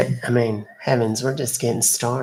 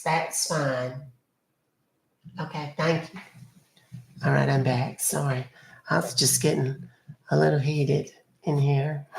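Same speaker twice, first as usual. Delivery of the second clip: ordinary talk, nothing marked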